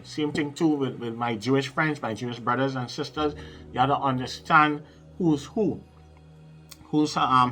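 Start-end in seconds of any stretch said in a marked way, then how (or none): none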